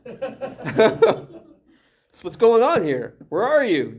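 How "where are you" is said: raised, higher voice of a displeased dad; calling out. Acted. Dad home and not happy, calling out to his kids. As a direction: announce yourself to hiding kids. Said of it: "I call out".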